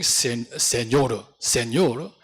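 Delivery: imitated Korean-accented French "Señor", low and slow; strong Korean accent. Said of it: "with a very strong Korean accent".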